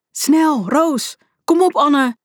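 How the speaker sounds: high, pressing shouts; urgent calling out. A narrator's reading. Tobias urges friends through the fence, calling urgently. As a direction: call friends on, pitch way up. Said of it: "riep Tobias".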